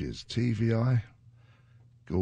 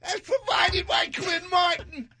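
mock announcer shout. Joking shout over co-host.

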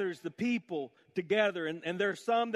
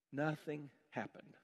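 ordinary talk

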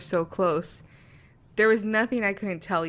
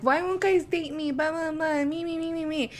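high, lightly mocking whine; mock whiny voice; 8 semitones higher than usual. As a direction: imitate a self-pitying friend. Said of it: "this verbiage that you use".

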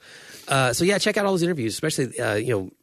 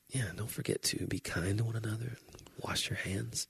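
NPR voice; 11 dB quieter than usual. Retold or imitated. Soft NPR sign-off continued.

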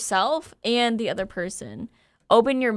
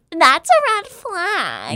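high, drawn-out mock red-flag warning; mock alarmist; 7 semitones higher, 4 dB louder, slower than usual. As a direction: mimic a snap-judging influencer, stretched out. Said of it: "you can't just automatically be like".

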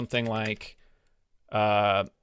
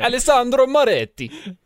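Alessandro Moretti, rolled Italian r, much higher; hammed-up Italian pronunciation of Alessandro Moretti; Italian accent; 11 semitones higher, 5 dB louder than usual. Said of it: "in the correct Italian accent".

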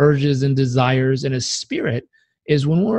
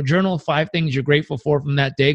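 normal talking voice

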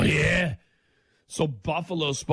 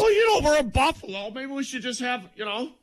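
mocking owner voice; 10 semitones higher than usual.

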